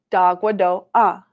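whole word in one connected go. Shown as a demonstration. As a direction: link "Da, gua, do, ah" smoothly with no gaps. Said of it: "say it a little bit quicker".

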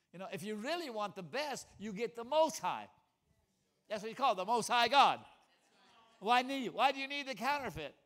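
high, loud revival preaching; preaching at full force. Acted. Preacher firing up the congregation about the Most High. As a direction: lift the whole church with it.

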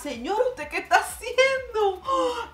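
high laughing disbelief, then a squeal; laughing shriek. Real, not acted. Vlogger asking the nail artist, laughing and high. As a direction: laugh out a shocked question. Shocked at long nails.

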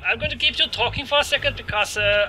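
high-pitched fake German accent; stupid German accent. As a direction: stall them in a hammy German accent. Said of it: "making stupid German accents".

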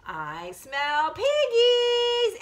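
slow, drawn-out, rising wolf call; big bad wolf. Big bad wolf sniffing out the pigs, slow and high. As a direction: savour the scent, stretching each word high. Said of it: "It's the big bad wolf, and he says".

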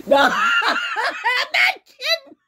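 high, loud laughing squeal; laughing hard. Real, not acted. Streamer insists she is not kidding, laughing hard. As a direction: break into a cackle mid-sentence. Cracks up mid-sentence.

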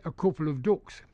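"ducks" with a Northern oo vowel, like "dooks"; Northern English accent. Shown as a demonstration. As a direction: say it as a Northern Englander, "coople of dooks". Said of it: "in the north of England".

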